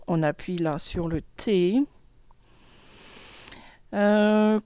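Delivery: usual speaking voice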